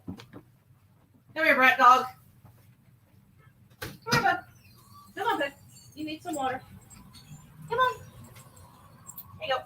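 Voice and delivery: high, soft sing-song calling the dog; high coaxing dog talk. Real, not acted. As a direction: coax the dog over sweetly.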